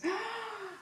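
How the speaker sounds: high startled gasp; sharp surprised gasp. Real, not acted. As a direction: gasp in real shock. Card reveal.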